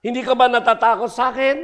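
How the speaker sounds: high, loud, fast devil's question; Satan voice. Acted. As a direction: press him like a menacing devil. Satan questions old man.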